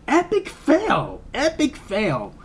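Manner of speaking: high, drawn-out ridicule; exaggerated high-pitched scorn; mocking verdict. Real, not acted. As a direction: jeer it slowly in a high voice. Mock verdict on the film's flaw.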